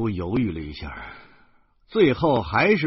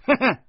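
forced high little laugh; a hollow put-on laugh; fake chuckle; 6 dB louder than usual. Guardian forcing a laugh to calm the girl, fake chuckle. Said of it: "我还假装笑了一下".

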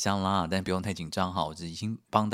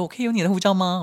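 high, louder sweet request; chirpy receptionist; 11 semitones higher, 7 dB louder, faster than usual. Retold or imitated. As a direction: play the too-sweet clerk. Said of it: "他说". (高亢甜腻地询问)

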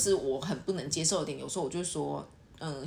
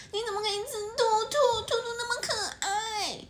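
put-on cutesy girl voice. Girl pretending cute over eating bunnies, whiny baby voice. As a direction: play a girl acting adorable and pouty. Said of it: "有些女生很喜欢装可爱".

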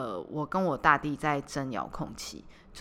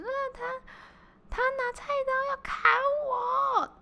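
crying to dad. Retold or imitated. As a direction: wail it to dad like a wronged kid. (向爸爸哭喊告状)

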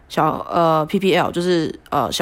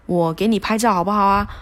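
higher, coaxing big-brother tone; coach coaxing; 4 semitones higher than usual. Retold or imitated. Coach offering a photo to the crying girl, higher coaxing. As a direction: coax a crying girl kindly. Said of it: "他就说".

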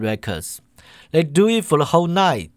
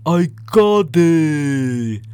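slow, loud triumphant cry; loud exclamation; 2 semitones higher, 5 dB louder, much slower than usual. A narrator's reading. Professor announcing his sudden insight, loud and slow. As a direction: blurt out the discovery, drawn out. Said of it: "claps his hands and says loudly".

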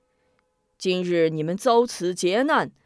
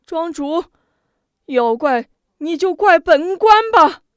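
much higher, loud wailing lament; weeping lament; 9 semitones higher, 8 dB louder than usual. Acted. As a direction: wail in showy grief, blaming himself. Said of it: "痛哭流涕". (痛哭流涕地哀叹)

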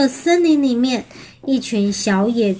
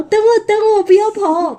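high, urgent storybook call; pitched-up pursuer's cry; chasing wolf; 10 semitones higher, 4 dB louder than usual. Acted. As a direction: plead after them in a raised, carrying voice. Wolf calls after the runaways.

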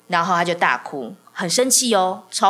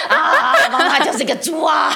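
laughing, much higher and louder; laughing joke; 10 semitones higher, 6 dB louder than usual. Real, not acted. Mother jokes to the host that she is a pig, laughing loudly. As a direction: laugh at your own joke as you say it.

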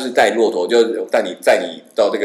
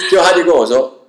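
usual speaking voice

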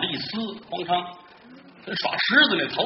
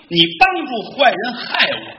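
higher, louder, slow accusation; indignant reproach; 5 semitones higher, 6 dB louder, slower than usual. Acted. Bai Xiaoping slowly and loudly reproaches the man who helped villains. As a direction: lay the blame on him word by word. Hero reproaches the fake ghost.

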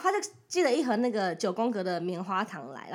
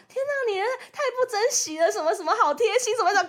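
higher, half-mocking scold; mock scolding commenters; 8 semitones higher, 4 dB louder than usual. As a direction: lightly parody the preachy crowd. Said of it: "大家就会在那边说什么". (略带嘲讽地模仿网友说教)

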